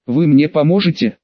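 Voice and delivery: usual speaking voice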